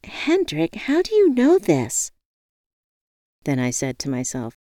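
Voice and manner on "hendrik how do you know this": high, amazed question; awed. A narrator's reading. Woman asks her knowing friend, awed. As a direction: ask it in wonder, pitch lifted. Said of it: "In awe".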